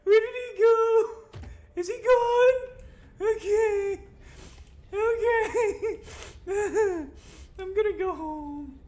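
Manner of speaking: very high, squeaky whimper; frightened squeal. Real, not acted. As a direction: squeak it out, shaken after the scare. Checking whether the monster left.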